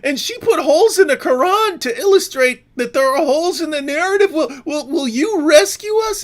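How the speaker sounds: very high, loud whiny pleading; hammed-up panicked crybaby; mock crybaby wail. Mocking Muslims begging police for help.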